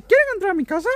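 very high squeaky cartoon-mouse voice; Mickey Mouse. Shown as a demonstration. Vlogger showing friends a different way to talk, Mickey Mouse impression. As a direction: greet fans in a high-pitched cartoon falsetto. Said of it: "Le voy a decir".

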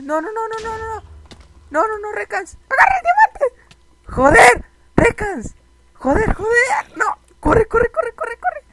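very high, loud frantic shouting; panicked yelling. Real, not acted. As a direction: scream at your teammate to escape. Peligro repentino junto al diamante. (gritos agudos de pánico)

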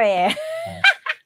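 high-pitched burst of laughter; real loud laugh. Real, not acted. Teacher joking about her old cartoons, bursting into a loud laugh. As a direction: laugh out freely at your own remark. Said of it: "อุ๊ย หัวเราะเสียงดัง".

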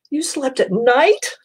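high, slow, aghast question; shocked gasp. A narrator's reading. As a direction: gasp it out in disbelief. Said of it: "gasped another".

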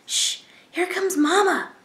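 high hushed chick warning; little bird warns the others mama is coming; hissing baby bird. Acted. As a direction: hiss it like a chick about to get caught. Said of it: "hissed Pip".

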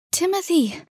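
high-pitched falling cry of recognition; startled exclamation. Acted. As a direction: cry out the name on finding him. Second girl arrives, finds him.

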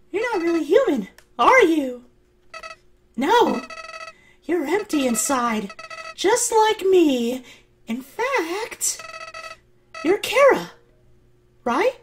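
put-on high, fast eerie child voice; creepy high voice. Voicing creepy high character.

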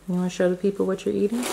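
normal talking voice

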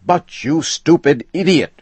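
high, loud furious insult; angry shout. A narrator's reading. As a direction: explode at the agent. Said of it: "shouted Mister Morehouse".

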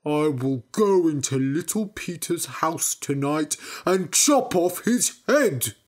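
mildly angry storybook vow, slowing at the end; unhappy threat. A narrator's reading. Big Peter, laughed at in the market, vows revenge angrily. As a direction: build to a menacing last phrase. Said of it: "he was not happy and said".

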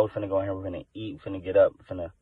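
normal talking voice

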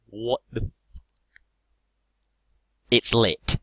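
usual speaking voice

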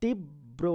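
তীব্র said softly as "tibbro", the ব doubled; pronunciation demo. Shown as a demonstration. Teacher shows students the doubled ব in তীব্র. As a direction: double the ব in তীব্র, "tib-bro". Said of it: "বটা কিন্তু দুইবার হয়ে যাচ্ছে".

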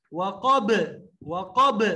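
وقب ending in a loud bouncing ب; qalqala on ba. Shown as a demonstration. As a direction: pop the final ب of وقب. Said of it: "با میں آواز ہلتی ہوئی".

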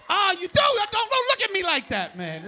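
high, loud mock shock; surprised at crowd. Real, not acted. Comic to audience member, loud mock surprise. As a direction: call out a fan in mock shock. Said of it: "reacts to audience".

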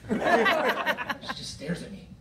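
laughing through it, high and slow; giggling deadpan delivery. Real, not acted. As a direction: crack up while telling it. Comic deadpan stare, laughing.

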